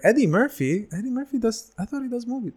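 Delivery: high-pitched incredulous questioning; puzzled, high-voiced double take; retold surprise. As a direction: react in amazed disbelief, voice jumping up. Retells his amazed discovery.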